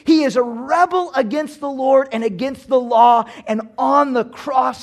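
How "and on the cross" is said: ordinary talk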